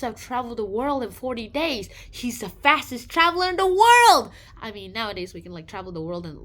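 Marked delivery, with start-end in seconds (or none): 2.1-4.3 high, loud proud announcement; amazed exclaim. Retold or imitated. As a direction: announce the record. Kid excited by record.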